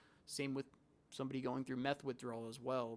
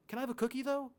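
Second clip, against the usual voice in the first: high, sweet little ask; mock-childlike request, pitched high; patient turns sweet and asks the nurse for a cookie, high voice; innocent patient; 10 semitones higher, 5 dB louder, faster than usual.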